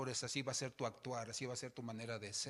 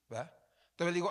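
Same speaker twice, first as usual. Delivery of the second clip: ordinary talk, nothing marked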